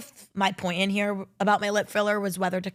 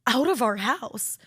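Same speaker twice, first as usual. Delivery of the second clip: mock annoyed roommate; 2 semitones higher, slower than usual. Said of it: "But Emily's like".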